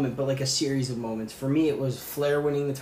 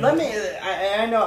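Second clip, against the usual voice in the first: laughing; 8 semitones higher, 4 dB louder than usual. Real, not acted. Laughing while changing topic.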